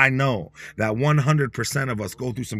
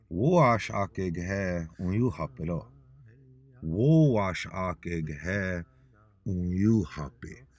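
lower, very slow, word by word; slow teaching phrase; 5 semitones lower, 4 dB quieter, much slower than usual. Shown as a demonstration. Teacher leading listeners in a chant, slow and low. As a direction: Woashake gehe unyuhape drawn out slowly so they can repeat. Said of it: "Say it with me".

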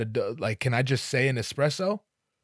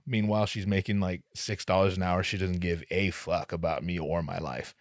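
ordinary talk, nothing marked

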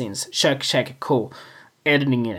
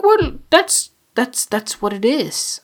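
squeaky high self-quote; high mock quote; 7 semitones higher, slower than usual. Telling viewers what he thought, squeaky high. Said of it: "I'm like".

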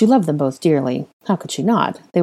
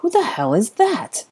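higher, slow, incredulous question; stunned question; 7 semitones higher than usual. Acted. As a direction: ask it in disbelief. Stunned man sees unknown child.

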